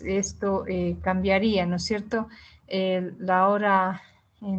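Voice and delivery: ordinary delivery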